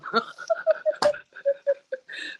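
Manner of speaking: a sudden high burst of laughter; big laugh. Real, not acted. Host laughing at her own breeding story, big high laugh.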